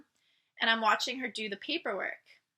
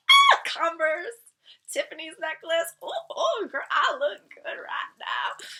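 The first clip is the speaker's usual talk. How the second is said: higher, half-whispered happy gushing; delighted cooing; 6 semitones higher, slower than usual. Retold or imitated. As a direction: coo over your things like treasures. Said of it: "I'm like".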